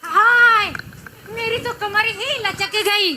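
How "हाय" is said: loud pained "haay"; pained cry. Acted. Mother-in-law straining her back dancing, loud pained cry.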